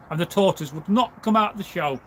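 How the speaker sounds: usual speaking voice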